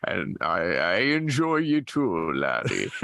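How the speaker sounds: lightly voiced pirate captain; a hint of a salty old pirate; Captain Grimsley Carver. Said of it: "I do Captain Grimsley Carver, the pirate".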